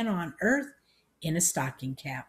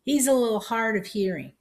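put-on high, raised old-lady voice; loud country aunt explaining; calling out; 6 semitones higher, 5 dB louder than usual. A narrator's reading. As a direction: call it out as a helpful warning. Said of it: "Aunt May called out".

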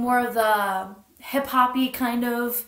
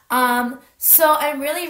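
normal talking voice

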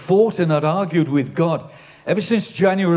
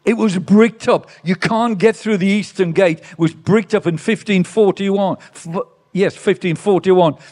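louder, raised preaching voice on the main point; preaching at full force; 3 semitones higher, 5 dB louder than usual. Acted. Pastor urging his congregation to take up their cross, preaching at full force. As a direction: drive the message home to the congregation. Preaching the Palm Sunday message.

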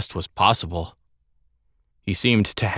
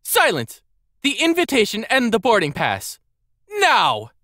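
high, imperious demand; angry command; 14 semitones higher than usual. A narrator's reading. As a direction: cut him off and order him sharply. Furious young lady orders her butler.

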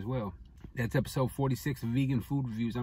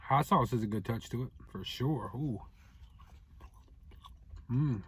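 eating and talking at once; talking while chewing; 3 semitones lower, 6 dB quieter than usual. Real, not acted. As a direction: mouth partly full of food. Seen 4: bites fish at -3 and 2.8, chews through the speech.

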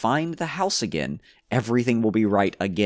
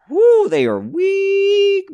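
very high, loud, drawn-out wail; high falsetto exclamation; 8 dB louder, much slower than usual. Acted. Player bemoaning nearly broken boots, high falsetto.

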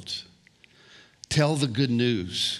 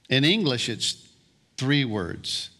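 ordinary talk, nothing marked

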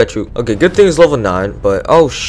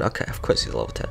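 resigned mutter; 7 semitones lower, 14 dB quieter, faster than usual. Real, not acted. Deflated aside.

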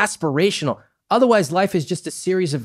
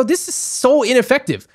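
exasperated; 12 semitones higher, 3 dB louder than usual. Retold or imitated. Her retold exasperated reply.

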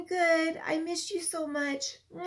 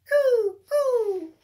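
pigeon coo; 5 semitones higher, 8 dB louder than usual. Acted. Teacher cooing as pigeons for a child, lightly.

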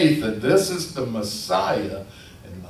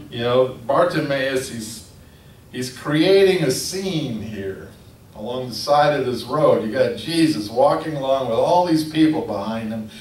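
ordinary delivery

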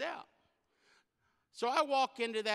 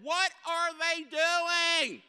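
much higher, slow bewildered question; baffled; 11 semitones higher, 7 dB louder, much slower than usual. Retold or imitated. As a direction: stretch it out in disbelief. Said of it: "and I said".

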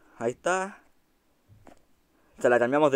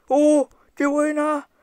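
much higher, loud delighted cry; excited cheer; 12 semitones higher, 5 dB louder, slower than usual. Real, not acted. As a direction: cheer the great shot. Cheering a great shot.

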